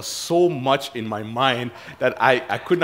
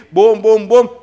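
loud, high punched beats; imitated festive thumping; booming celebration; 9 semitones higher, 13 dB louder, slower than usual. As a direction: pound out each boom like a drum.

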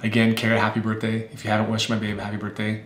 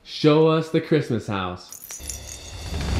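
slow drawn-out prompt; 6 semitones higher, much slower than usual. Real, not acted. Playful show prompt.

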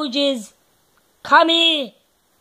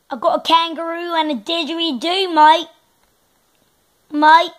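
put-on Australian accent; 2 semitones higher, faster than usual. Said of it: "now we're gonna do Australian".

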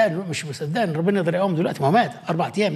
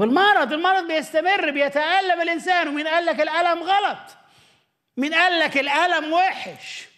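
raised challenge; 10 semitones higher than usual. Acted. Voicing God's challenge.

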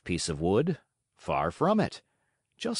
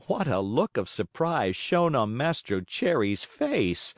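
plain reading voice

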